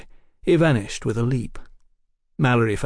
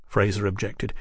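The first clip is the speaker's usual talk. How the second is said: usual speaking voice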